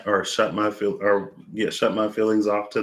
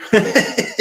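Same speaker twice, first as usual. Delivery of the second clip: breathy little giggle; 8 dB louder than usual. Real, not acted. Giggles about friends' success.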